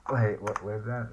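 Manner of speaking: usual speaking voice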